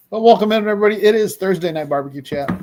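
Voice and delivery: loud, high show welcome; hyped welcome. Real, not acted. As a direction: pump up the crowd. Co-host opening greeting.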